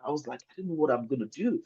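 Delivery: usual speaking voice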